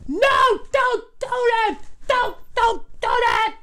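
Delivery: put-on frightened little boy pleading, high and drawn out; pleading child. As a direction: beg her to stop. Child begging not to.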